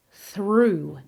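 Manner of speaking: through with a long, held "oo"; long oo vowel. Shown as a demonstration. Teacher modelling through for learners, long oo vowel. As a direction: say through to rhyme with shoe. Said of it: "This has a long oo vowel".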